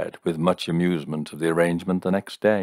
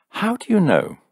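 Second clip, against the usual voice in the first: sharp high demand, louder; 4 dB louder than usual. A narrator's reading. Woman demanding he explain himself, sharply high. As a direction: she pushes back hard, pitch jumping up. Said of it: "she demanded".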